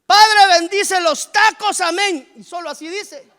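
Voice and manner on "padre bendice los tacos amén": much higher, much louder, slow hammed-up grace; mock a lazy believer blessing his tacos, shouting it out; a hammed-up grace before meals, very high and very loud; mock quick grace. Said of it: "cuando ora por la comida".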